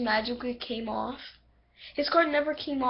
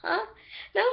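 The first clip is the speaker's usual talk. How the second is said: normal talking voice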